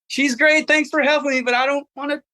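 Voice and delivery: married traveler turning down an offered bride, flustered; awkward polite refusal. Retold or imitated. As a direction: squirm out of it politely. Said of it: "in the most awkward position".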